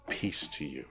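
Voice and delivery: low, soft tender blessing; gentle low voice of Jesus greeting a doubter; tender hush. Pretend.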